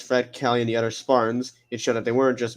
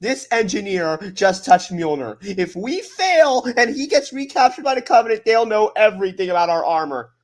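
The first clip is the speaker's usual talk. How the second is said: raised, forceful explaining voice; acted heated justification; urgent argument; 7 semitones higher, 5 dB louder than usual. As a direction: argue back loudly, pitch pushed up. Elias argues back at Cortana.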